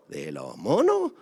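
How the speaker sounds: low, slow disbelief; incredulous. Real, not acted. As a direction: drop it in dry disbelief. Incredulous reply. (incrédulo, lento y grave)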